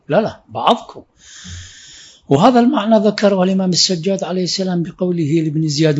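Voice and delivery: ordinary delivery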